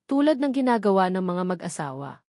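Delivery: ordinary delivery, nothing marked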